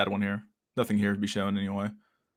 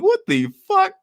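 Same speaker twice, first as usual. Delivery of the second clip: squeaky, much higher and louder shriek; shrieking what the fuck; 7 dB louder, slower than usual. Retold or imitated. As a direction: shriek it in a cartoonish falsetto. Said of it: "I'm like".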